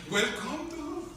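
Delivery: high, put-on welcome; carcelero acogedor. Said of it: "Ahora sí le decía". (bienvenida fingida, voz aguda)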